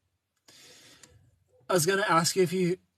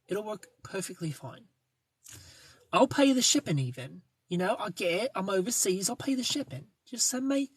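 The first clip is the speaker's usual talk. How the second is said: higher, put-on British accent claiming to be from the UK; mock British accent; 3 semitones higher than usual. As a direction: play the Brit begging a brand for free clothes. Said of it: "remind them you're from the U K".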